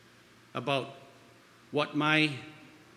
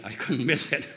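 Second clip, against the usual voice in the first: normal talking voice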